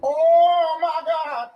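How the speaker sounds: high, slow, drawn-out gasp of shock; stunned Auntie. Retold or imitated. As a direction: stretch it out like Auntie can't believe it. Auntie's shocked reaction repeated.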